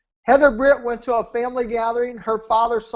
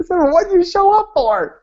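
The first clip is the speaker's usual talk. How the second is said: high, loud, incredulous comeback; exasperated retort; 8 semitones higher, 6 dB louder than usual. Retold or imitated. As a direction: throw the question back, pitched way up. Retorting to her refusal to appear.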